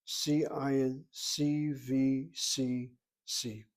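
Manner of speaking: "C I N C V C C" spelled slowly letter by letter; slow, deliberate spelling out; sounding out words. Shown as a demonstration. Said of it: "Watch how I break it into syllables".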